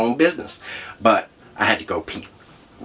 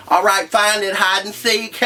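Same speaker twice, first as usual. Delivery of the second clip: higher, playful teasing; cheeky taunt; 11 semitones higher than usual. Retold or imitated. Handing the wallet to the officer, playful high taunt. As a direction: toss it off like a game. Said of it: "and I'm like".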